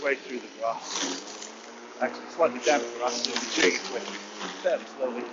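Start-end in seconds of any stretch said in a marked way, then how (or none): none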